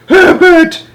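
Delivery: very high, loud shriek of a name; shrill scream. Acted. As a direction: scream for your husband in panic. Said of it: "it was screaming".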